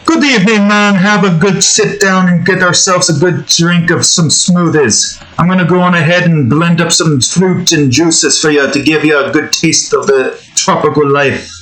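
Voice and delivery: Jamaican accent. Shown as a demonstration. Game dev improvises a Jamaican bartender welcome. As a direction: welcome a guest to the islands in Jamaican. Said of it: "Let me do just regular Jamaican".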